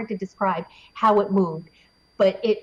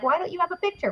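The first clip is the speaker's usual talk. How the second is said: squeaky high exasperated aside; mock exasperation; 9 semitones higher, 4 dB quieter, faster than usual. Mocking people who ask for photos, high and exasperated. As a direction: play the annoyed skeptic, pitched way up.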